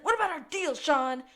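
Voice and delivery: a mock high, pleading Gus voice. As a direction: beg him to keep the deal, high-pitched. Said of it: "and he's like".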